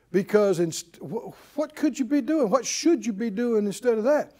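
ordinary talk, nothing marked

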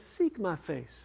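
quiet, slow, gentle command; the Lord answering gently; soft voice of God. Acted. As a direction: say it softly and slowly, like a calm answer. Voicing the Lord's answer.

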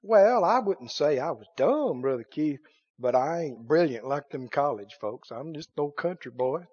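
lightly voiced country boy, low and soft; humble country objector. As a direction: play a modest objector, dropping low and quiet.

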